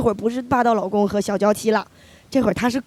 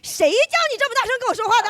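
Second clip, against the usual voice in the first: fast, high mock scold; mother scolding her daughter's friend too; mom's scolding; 11 semitones higher, much faster than usual. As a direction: rattle it off like an outraged mother. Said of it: "这句话会变成".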